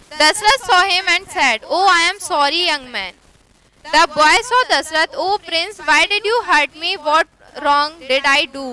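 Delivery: reading the English text slowly and clearly; slow story reading. A narrator's reading. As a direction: read it out slow for the class, each word distinct.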